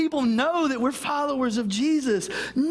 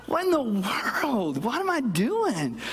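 embarrassed realization; slower than usual. Retold or imitated. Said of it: "And I'm like".

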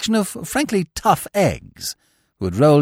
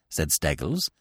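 usual speaking voice

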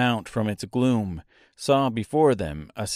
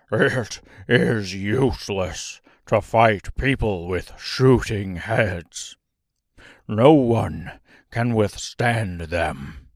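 slow, defeated lion, pitched down a little; bruised, sore lion; 3 semitones lower, slower than usual. A narrator's reading. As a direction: give up slowly, sore from the fall. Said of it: "feeling rather bruised and sore".

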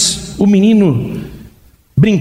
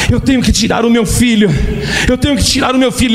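desespero; 6 semitones higher, faster than usual. Acted. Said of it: "aquele homem no drama".

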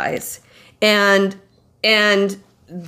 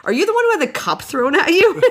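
amused officer; 8 semitones higher, faster than usual. Retold or imitated. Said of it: "He's like".

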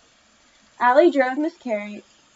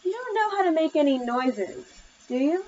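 higher, mildly worried question; 6 semitones higher than usual. A narrator's reading. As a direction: voice a mother's understated worry.

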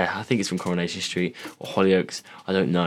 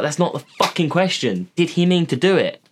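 mock exasperated questioning; 8 semitones higher than usual. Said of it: "It was like".

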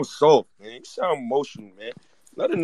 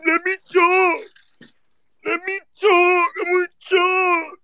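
much higher, slower whining shout, mocking the rival fan; mocking crybaby; 11 semitones higher, slower than usual. Host mocking the Cleveland fan who demanded to talk, in a high, crying whine. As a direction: whine it like a kid throwing a fit. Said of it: "that's the Cleveland fan crying".

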